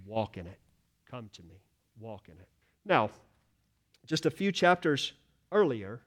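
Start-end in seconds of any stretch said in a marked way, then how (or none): 0.1-2.5 much softer and lower, a tender invitation; gentle soft voice. Acted. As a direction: speak it like a quiet loving call. Voicing Christ's gentle call.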